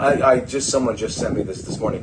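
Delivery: ordinary delivery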